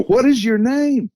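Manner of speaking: expressive call. Retold or imitated. To the creature, repeated call, high and expressive. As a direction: call out high and sing-song. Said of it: "more expressively".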